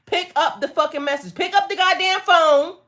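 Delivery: from loud to a high, loud yell; building to a shout. Real, not acted. Scolding viewers who miss the message, building to a shout. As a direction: push it up until you're shouting.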